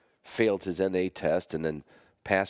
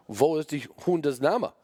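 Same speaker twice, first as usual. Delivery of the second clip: put-on higher, slow German-accented question; 8 semitones higher than usual. Imagined German judge repeating the question slowly, German accent. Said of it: "German judges or German immigrants".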